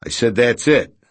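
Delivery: harsh tone. A narrator's reading. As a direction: slam the door on the talk. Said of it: "Redrick replied in a harsh tone".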